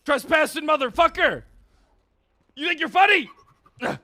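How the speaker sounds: high, loud enraged shouting at trespassers; furious cop yelling. Acted. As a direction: bellow them off your land like a furious cop. Cop yells at trespasser again.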